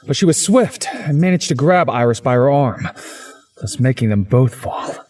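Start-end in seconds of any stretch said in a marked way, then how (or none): none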